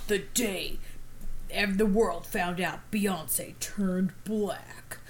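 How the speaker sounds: slow, hushed horror-trailer whisper; Purge trailer voice. Retold or imitated. Sister retelling the skit in a hushed slow movie-trailer whisper. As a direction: breathe it like a creepy movie trailer. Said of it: "in the voice of the trailer for The Purge".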